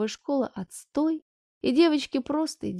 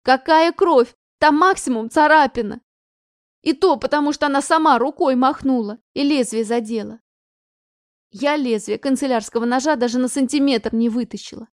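heated retort; 2 semitones higher, 6 dB louder than usual. Acted. Daughter protests mother's exaggeration, loud heated retort. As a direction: fire back in exasperation. Anya arguing back loudly.

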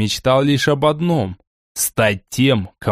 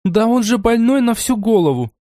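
outraged; 9 semitones higher than usual. Acted. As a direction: rant it high at him. Amelia ranting.

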